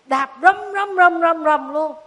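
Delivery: loud mimicked bangs; banging sound. Storyteller mimicking Tí banging the car door, loud thumps. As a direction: thump out the pounding noise.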